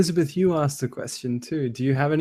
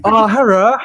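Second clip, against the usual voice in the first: mock broken-English accent; 9 semitones higher, 10 dB louder than usual. Playing along with a joke about Japanese, mock broken-English accent. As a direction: put on a crude broken-English accent, pitched high. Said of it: "like, broken English".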